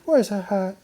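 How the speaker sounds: put-on high urgent girl voice; excited girl. A narrator's reading. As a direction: search urgently. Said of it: "someone shouted".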